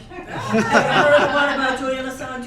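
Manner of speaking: loud, laughing pitch of a joke setup; bright joke-teller opener. Shown as a demonstration. Speaker shows the crowd how to answer, loud joking opener. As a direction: sell it like a comedian's opener. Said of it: "Please respond by saying".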